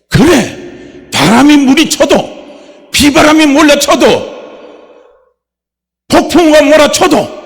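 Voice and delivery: 높고 크게 외치는 믿음의 고백; 온 힘으로 선포하듯 외쳐라. Acted. 설교 절정의 선포. (높고 크게 외치는 설교 선포)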